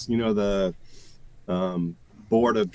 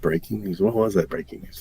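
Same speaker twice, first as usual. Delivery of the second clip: normal talking voice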